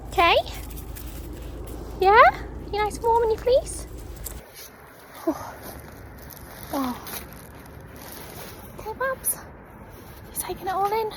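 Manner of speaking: high, soft cooing to the dog; pet voice. Real, not acted. Owner softly checking on her dog in pet voice. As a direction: coo gently at him.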